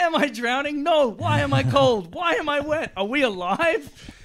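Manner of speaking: high, loud panicky questions; body panicking under cold water, high and frantic; panicked body voice. Pretend. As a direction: voice your body freaking out in a mock panic. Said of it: "'Cause it's like".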